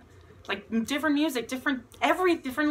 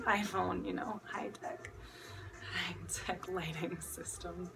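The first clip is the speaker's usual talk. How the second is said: whispered aside; 3 semitones lower, 10 dB quieter than usual. Real, not acted. Joking aside about phone lighting.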